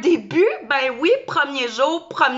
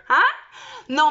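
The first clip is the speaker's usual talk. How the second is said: usual speaking voice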